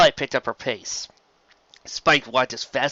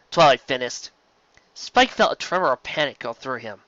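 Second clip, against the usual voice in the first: usual speaking voice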